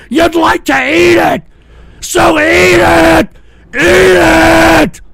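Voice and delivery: building to a slow, stretched bellow; enraged taunt. Real, not acted.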